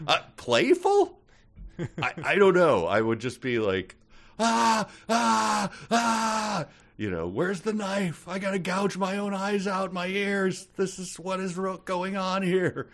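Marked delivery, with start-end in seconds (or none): 4.4-6.6 high-pitched mock screams; mock panicked screams. Imagined panicked reaction to the voice. 7.3-12.9 high-pitched hammed-up freak-out; mock panic. Acting out panic at hearing voices.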